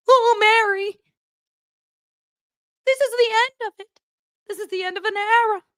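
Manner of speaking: high-pitched mock choked-up voice; hammed-up weepy farewell, struggling to keep it together; holding back tears. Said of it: "[name] is trying his hardest to keep it together".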